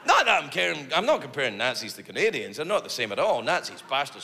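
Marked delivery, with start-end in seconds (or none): none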